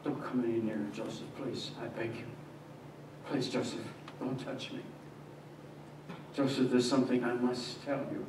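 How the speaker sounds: soft, hushed begging; whispered plea. Acted. Mary begging Joseph not to touch her, hushed. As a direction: beg him quietly to stay back. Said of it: "she whispered".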